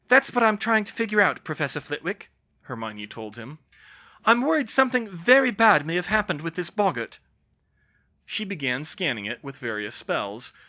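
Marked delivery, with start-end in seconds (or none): 0.1-7.2 worried. Acted. Hermione answers Flitwick.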